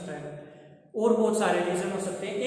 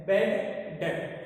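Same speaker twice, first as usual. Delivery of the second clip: "बैड", pause, then "डेट"; word by word. Shown as a demonstration. Repeats new term word by word. ("बैड डेट" रुक-रुक कर, शब्द अलग-अलग)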